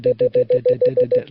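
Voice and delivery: "dit, dit, dit, dit" blurred into one continuous chain; run-together dits. Shown as a demonstration. Morse teacher showing how dits should flow, run together. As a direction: let the dits run into each other. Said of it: "like they're running into each other".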